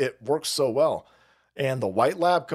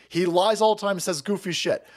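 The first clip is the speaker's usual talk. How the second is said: normal talking voice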